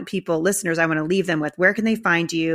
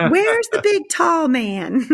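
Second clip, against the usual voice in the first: put-on high, bright child asking; a much higher voice for a little girl's question; 12 semitones higher, 5 dB louder, much slower than usual. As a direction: squeak it out like a small girl. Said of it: "she was like".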